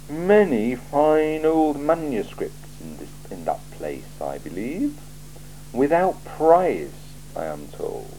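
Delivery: a cool ruler weighing every word; icy, deliberate interrogator; Lord Vetinari. Acted. Vetinari hints darkly.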